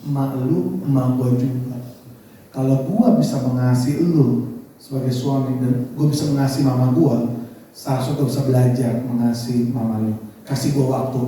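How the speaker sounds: low, calm, earnest voice; patient wife's reply. Retold or imitated. Wife promises to learn to love mother-in-law, calm and low. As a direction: concede gently, pitched well down. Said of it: "orangnya sabar".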